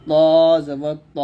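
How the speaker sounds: ত held long from upper teeth roots, then short; letter articulation demo. Shown as a demonstration. Teacher showing students tongue placement, ত long then short. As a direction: stretch the first ত, cut the last one short. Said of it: "এরকম".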